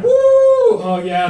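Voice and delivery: high, loud woo; joyful yell down the slide; whooping on a slide. Pretend.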